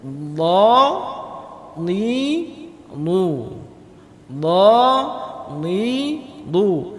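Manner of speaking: dha, ni, lu syllables with a pressed dhad; teacher drilling dha ni lu. Shown as a demonstration. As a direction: press the dha hard, then ni and lu. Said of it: "Tepi lidah kita itu harus kena dengan".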